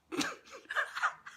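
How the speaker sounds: high airless giggle; breathy laugh. Real, not acted. Vlogger laughing at her own story, airless giggle. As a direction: giggle at how silly it sounds.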